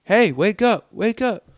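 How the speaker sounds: loud, high urgent calls to wake him; calling out. Retold or imitated. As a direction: shout to rouse a man lying on the ground. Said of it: "Shake him like".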